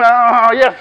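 loud, high burst of relieved discovery; mock delight. Speaker finds an interested face in the audience, mock delight. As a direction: seize on it with hammed-up joy. Acts spotting an interested listener.

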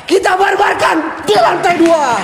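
high hype-up shout to the viewers; excited shout. Real, not acted. Hypes viewers before going upstairs. (teriakan semangat ke penonton)

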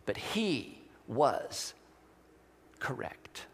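hushed tribute to the martyred translator; solemn hush. Acted. Solemn close to martyr story.

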